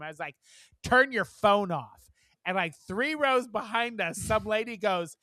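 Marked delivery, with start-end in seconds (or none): 0.8-2.1 firm, loud, drawn-out order; military voice. Retold or imitated. Ordering a moviegoer off her phone, military voice. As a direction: issue it like a stern military command. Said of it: "This is my military voice".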